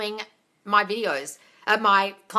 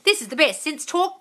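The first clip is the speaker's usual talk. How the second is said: ordinary talk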